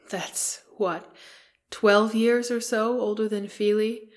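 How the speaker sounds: ordinary delivery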